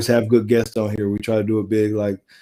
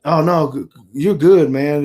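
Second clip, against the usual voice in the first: higher-pitched, emphatic brush-off; mock reassurance; 5 semitones higher, 6 dB louder than usual. Team staff reassuring a benched player, mock reassurance. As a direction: imitate someone waving away a worry.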